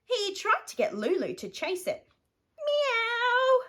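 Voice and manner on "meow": high mewing cat voice; little high meow. Acted. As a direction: mew like a cat. Cat Lulu responds.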